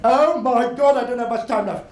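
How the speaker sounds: high, excited burst of realising time is short; flustered excitement. Real, not acted. Speaker to audience on running out of time, flustered and excited. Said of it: "how I get excited about these things".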